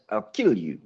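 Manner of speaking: low Russian-accented threat; deadpan threat in a Russian accent. Shown as a demonstration. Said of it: "say this".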